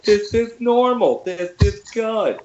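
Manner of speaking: high, drawn-out silly voice; mock oblivious woman. Comic mocking the peacock owner, high oblivious voice. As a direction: put on a high, slow voice insisting all is fine.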